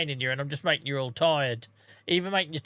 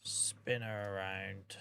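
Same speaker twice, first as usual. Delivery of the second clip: muttered; 6 semitones lower, 13 dB quieter, much slower than usual. Real, not acted. Quiet aside while working.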